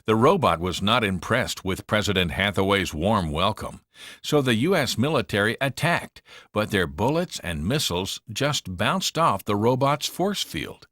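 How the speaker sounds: ordinary delivery, nothing marked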